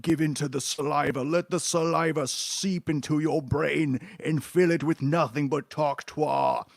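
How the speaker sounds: slow, drawn-out, hypnotic mock preacher of saliva; mock creepy cult voice. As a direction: lure them in like a sinister cult recruiter, slowly. Mocking the cartoon's message.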